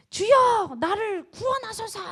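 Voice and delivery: high, loud plea that stays high; imitating a desperate shout for rescue; acted cry for help. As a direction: call out to the Lord to save you, pitched well up. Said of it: "이렇게 소리를 지르는 거예요".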